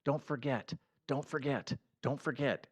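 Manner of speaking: soft repeated nudge to yourself; softly repeated self-reminder; quiet reminder. Retold or imitated. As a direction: murmur it like a note you keep rereading. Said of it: "I'm like".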